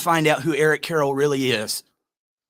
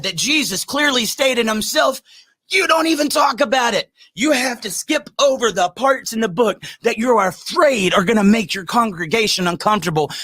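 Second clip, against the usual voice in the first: preaching at full force; 5 semitones higher than usual. Acted. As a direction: thunder it from the pulpit. Preaches against churches.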